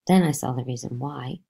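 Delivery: ordinary delivery, nothing marked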